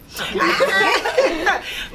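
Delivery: a loud high laugh. Real, not acted. Laughs after shout out.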